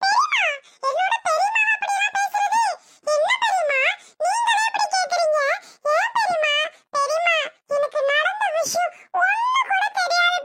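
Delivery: high-pitched, hurt pleading; pleading protest. Acted. Nephew protests to angry aunt. (உயர்ந்த குரலில் கெஞ்சல்)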